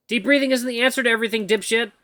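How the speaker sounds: high, fast annoyed retort; irritated snap. Acted. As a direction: snap at the useless advice. Snapping at the AI.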